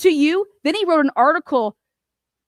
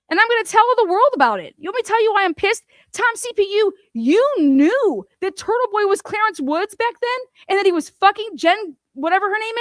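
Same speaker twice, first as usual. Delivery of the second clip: higher, heated tirade; real anger aimed at Tom; pissed off; 6 semitones higher than usual. Real, not acted. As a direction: rant fast and hot, pitch climbing. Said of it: "I'm so fucking pissed at you".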